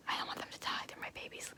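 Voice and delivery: very soft, hushed whisper; tiny whisper. Real, not acted. Owner fretting over the tadpole babies, whispering. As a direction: whisper it like a private plea.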